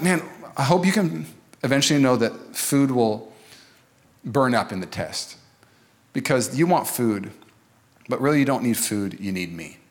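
a hint of a patient God, slowing down; God speaking. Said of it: "And God's like".

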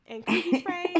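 high, loud, breaking into laughter; real laughter bursting through the words; laughing. Real, not acted. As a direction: crack up while telling it. Cracking up at the scene described.

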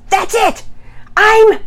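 high, loud furious outburst; quick, venomous. A narrator's reading. Brother firing back at his sister, venomous shout. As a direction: burst out, then break off mid-sentence. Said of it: "response was quick and venomous".

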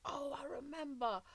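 sudden hushed whisper. Real, not acted. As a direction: breathe it out in a whisper. Sudden quiet recollection.

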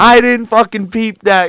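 loud, high, slow disbelief; real blown-away outburst; astonished. Real, not acted. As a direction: exclaim it, stunned you missed it. Astonished he never noticed.

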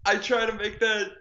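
playfully aggrieved outburst; exasperated. Real, not acted. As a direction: complain like it happens to you constantly. Exasperated that his argument gets derailed.

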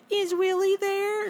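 high-pitched, drawn-out girl impression; teasing schoolgirl. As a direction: imitate a girl prank-calling, slow and high. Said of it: "phone calls from girls in my class".

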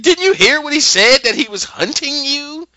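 high, loud angry rebuke; mad. Retold or imitated. Man scolding Dorothy for killing the guy, high angry voice. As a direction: scold her for what she did. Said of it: "he's about to be, like, mad at Dorothy".

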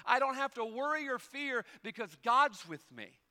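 loud, high, driving sermon delivery; preaching at full force. Acted. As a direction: preach it out, building energy.

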